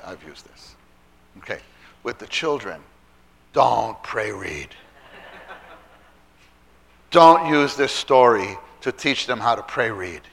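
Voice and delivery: ordinary talk, nothing marked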